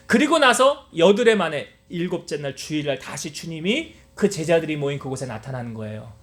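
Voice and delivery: ordinary delivery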